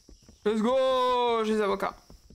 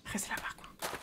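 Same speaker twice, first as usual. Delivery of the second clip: whisper; 13 dB quieter than usual. Real, not acted. Hushed so the creature performs.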